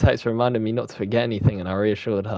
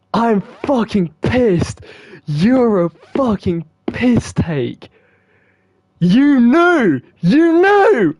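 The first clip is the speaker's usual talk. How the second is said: high, slow furious screaming; furious shout; 9 semitones higher, 5 dB louder, much slower than usual. Real, not acted. Gamer screaming at Monika for knowing all along. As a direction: scream it in outrage.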